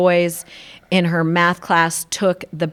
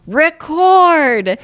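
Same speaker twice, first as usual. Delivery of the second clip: Record said higher and louder, commanding; 8 semitones higher, 4 dB louder than usual. Retold or imitated. Kid yelling at the VCR after brother says louder, loud command. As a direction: bark Record at the machine. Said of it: "It's not loud enough. Try again".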